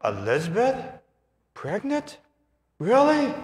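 high disbelieving questions; Mary amazed. Retold or imitated. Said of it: "Mary's going".

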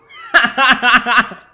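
high loud burst of laughter. Real, not acted. Gamer amused by the game, loud laugh.

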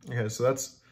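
usual speaking voice

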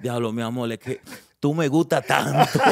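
mock pleading with a swell at the end; classic excuse. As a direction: imitate the sheepish guy's classic line. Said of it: "sabes cuál es la clásica?".

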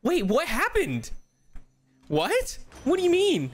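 high, loud shocked questions; sudden disbelief. Real, not acted. Shocked by the news.

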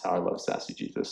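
soft unvoiced whisper to herself; whispered aside. Real, not acted. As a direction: breathe it out as a fond aside.

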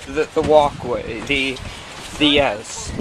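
ordinary talk

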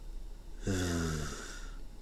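very soft, drawn-out unvoiced sigh; long tired sigh. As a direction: let the frustration out slowly. Boss sighs again, annoyed.

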